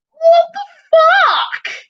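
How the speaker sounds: very high, loud, slow exclamation; astonished outburst. Real, not acted. Comparing the distance with the trip to Cairns, astonished. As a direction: stretch it out in squealing disbelief.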